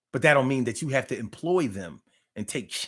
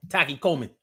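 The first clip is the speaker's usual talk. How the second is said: usual speaking voice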